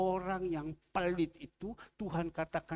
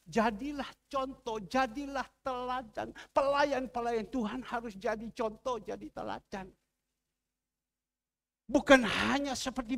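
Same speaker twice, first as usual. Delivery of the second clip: raised, emphatic pulpit exhortation; forceful preaching; 7 semitones higher, 4 dB louder than usual. Acted. Preacher warning God's servants to be examples, forceful preaching. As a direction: open loud and high, then drive the point home.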